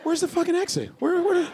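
high frantic panicked shout; panicked passenger. Retold or imitated. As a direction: scream it like you're trapped on a crashing plane. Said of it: "They go".

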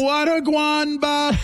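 put-on high squawky Jamaican-accented parrot. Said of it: "Did he have a Jamaican accent?".

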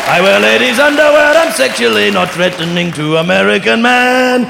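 mock his own sitcom English accent; over-the-top fake English accent; bad English accent. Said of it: "that is the worst English accent".

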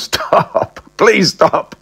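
can barely speak for laughing; laughing helplessly. Acted. Lawyer laughing at Ross's excuse, pleading through laughter. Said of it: "I haven't laughed this much".

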